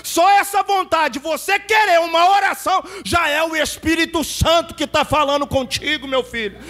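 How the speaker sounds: voz aguda de pregador a plena força; exortação bradada. Retold or imitated. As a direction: proclame agudo, esticando as palavras. Exortação encenada ao homem que chora. (brado fervoroso de pregador)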